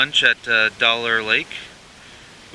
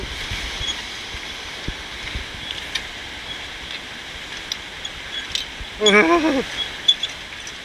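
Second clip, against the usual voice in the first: breathless laugh; 12 semitones higher, 6 dB quieter than usual. Real, not acted. Laughing while crossing.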